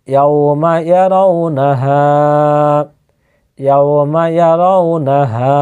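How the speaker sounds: slow ইয়াউমা ইয়ারাউনাহা, last হা held long; drawn-out final vowel. Shown as a demonstration. Teacher joining both words, slow recitation with long হা. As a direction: join the words slowly and stretch the final হা. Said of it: "হায় আলিফ মাদ্দা যুক্ত রয়েছে".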